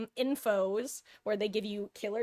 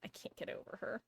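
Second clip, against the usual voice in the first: slow, soft whisper; hushed, slow whisper over the cat; tender whisper; 6 semitones lower, 11 dB quieter, slower than usual. Real, not acted. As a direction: whisper it, lost in adoration.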